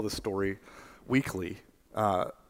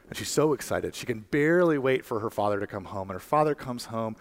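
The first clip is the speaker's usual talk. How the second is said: ordinary talk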